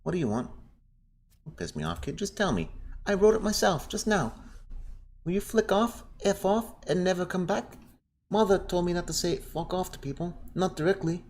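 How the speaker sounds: put-on squeaky, sassy little girl; very high, rude child voice. Voicing a girl character.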